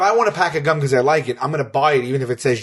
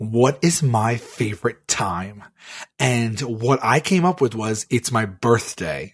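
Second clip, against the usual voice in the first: slow, lower, drawn-out delivery; mock pondering; 3 semitones lower, slower than usual. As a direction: muse slowly, trying not to laugh. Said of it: "talking like this".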